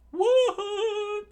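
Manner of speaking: very high, loud squeaky shout; chef screaming. Acted. Cook shocked at Luffy's plan, high loud shriek. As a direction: shriek in disbelief. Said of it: "the chef screamed out".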